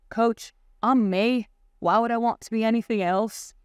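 a light attempt at the character's accent; Jamie Tart's accent. Quoting a TV footballer's answer to his coach, in a lightly attempted accent. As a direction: lightly imitate his accent, drawing out the opening words. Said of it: "in his accent, which I won't pretend to do".

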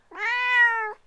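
very high, loud "Meow!"; the cat alarmed by the baby, yowling. A narrator's reading. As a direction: yowl like a startled cat. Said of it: "yowled Cora".

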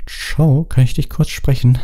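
nervous Harry asking Cho; nervous boy. Acted.

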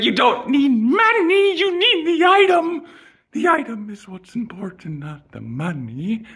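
squeaky emphatic insistence; high exasperated rant. Real, not acted. Ranting, voice rising high.

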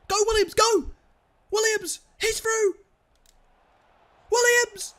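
high, loud cheering of a player through on goal; rising shout of hope for Williams; excited shouting. Real, not acted. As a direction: yell him on toward the goal.

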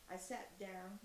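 plain reading voice

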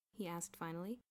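plain reading voice

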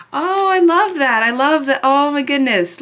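excited gushing. Real, not acted. Fan gushes over the new CD, excited and high. Delighted reaction to first seeing the CD.

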